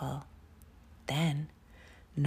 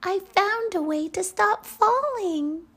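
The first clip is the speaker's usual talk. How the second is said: tiny tinkling snowflake whisper; 7 dB louder than usual. Acted. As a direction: squeak it out proudly like a snowflake. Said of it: "said the snowflake".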